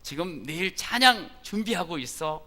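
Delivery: crisp and clear. Retold or imitated. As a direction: speak up brightly and clearly. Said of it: "또릿또릿하게".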